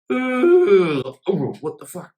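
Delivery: high, loud startled yelp, then a disgusted curse; startled gag. Retold or imitated. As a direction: choke on a finger mid-yawn.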